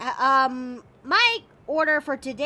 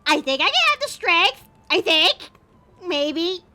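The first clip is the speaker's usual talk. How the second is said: nervous pep talk; 3 semitones higher, 7 dB louder than usual. Real, not acted. Psyching up nervously.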